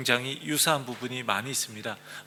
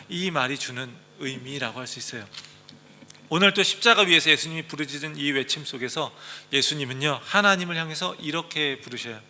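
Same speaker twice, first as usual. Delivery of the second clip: ordinary talk, nothing marked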